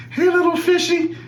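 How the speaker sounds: high sing-song call to the shrimp; playful high voice. Pretend. Cook sings to the shrimp.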